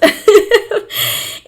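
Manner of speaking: short unvoiced burst of laughter. Real, not acted. Speaker laughing after cursing the world of grief.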